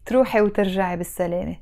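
put-on fast Arabic farewell to a girl. Shown as a demonstration. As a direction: rattle it off quickly. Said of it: "I'm gonna say it a bit faster".

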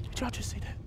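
"Did y'all just see that?" whispered with no voice. Real, not acted. Gamer whispering to viewers after a scare, hushed.

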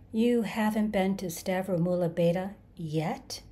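plain reading voice

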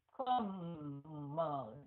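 "Come" very drawn out, low, humming on the M; exaggerated nasal ending. Shown as a demonstration. Teacher exaggerating for learners, long low nasal "Come". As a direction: stretch "Come" right down into the nose. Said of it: "mình xuống giọng mũi".

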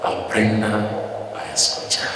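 breathy whispered monk. Retold or imitated. Monk whispering to the frantic father. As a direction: whisper it so he can't hear. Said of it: "el monje lo que está diciendo es".

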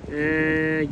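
normal talking voice

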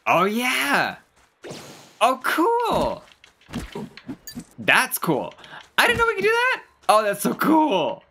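thrilled, leaping up in pitch; real excitement. Real, not acted. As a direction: geek out over a new power. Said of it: "I'm excited!".

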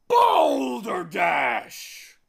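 huge, high, stretched-out bellow; booming shout. A narrator's reading. Humbug breaking into the bee's story, booming shout. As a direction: boom it across the whole market. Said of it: "shouted a booming voice".

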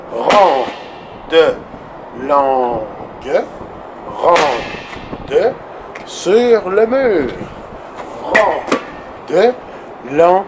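slowly stretched chant; drawn-out rhythm syllables. Shown as a demonstration. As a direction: hold each syllable for its full length. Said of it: "en syllabe". (syllabes étirées en rythme)

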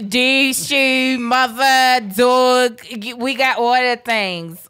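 loud hammed-up Baltimore accent. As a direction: stretch it out like a Baltimore local. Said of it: "got its own accent".

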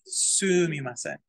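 Sumimasen with a long, drawn-out first "Su"; Sumimasen, first syllable stretched and stressed; emphasis on su-. Shown as a demonstration. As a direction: lean slowly on the Su of Sumimasen, then let it fall. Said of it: "With the emphasis on su".

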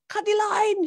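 high urgent shout. A narrator's reading. Boatman shouting at the crew to cut the line, loud and high. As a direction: yell the order across the deck. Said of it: "shouted Nysa".

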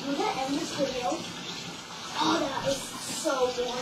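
loud, fast excited outro. Real, not acted. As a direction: wrap up with big energy.

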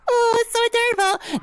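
very high cutesy squeal; gushing squeal. Acted. Streamer gushing over a little finger heart, high cutesy squeal. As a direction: coo over something adorable in a high voice.

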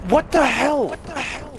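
high-pitched disbelief; shocked outburst. Real, not acted. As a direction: yelp it out in disbelief. Frustrated gamer outburst.

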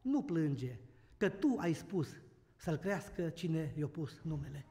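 low, soft and slow consolation; tender comfort. Retold or imitated. Scene: father embraces his weeping wife over their dead son, tender and soft. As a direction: hold her and soothe her gently. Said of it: "a luat-o după gât".

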